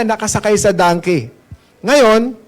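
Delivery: normal talking voice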